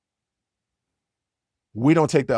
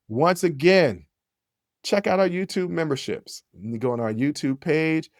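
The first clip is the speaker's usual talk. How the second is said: ordinary delivery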